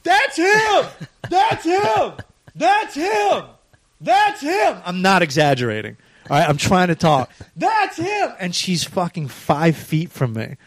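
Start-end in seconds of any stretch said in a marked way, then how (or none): 0.0-4.8 very high-pitched mock shriek; squealed, high caricature of a shouting woman. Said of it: "she's just shouting and pointing at her husband". 7.6-8.3 very high-pitched mock shriek; squealed, high caricature of a shouting woman. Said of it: "she's just shouting and pointing at her husband".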